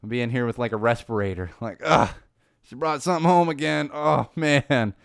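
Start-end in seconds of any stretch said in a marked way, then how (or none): 1.8-4.9 louder, higher mock moan of dismay; mock exasperated groan.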